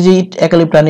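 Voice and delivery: ordinary talk, nothing marked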